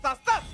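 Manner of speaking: loud, high "tas, tas" hit sounds; imitated hit sounds. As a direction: spit out "tas, tas" like punches. Imitating rapid hits.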